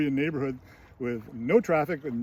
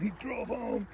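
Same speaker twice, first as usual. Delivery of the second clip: high, softer mock exclamation; mock reveal; 8 semitones higher, 5 dB quieter than usual. Real, not acted. Reminding listeners of the drive after bourbon, high soft mock reveal. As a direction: squeak out the reveal, held back in volume.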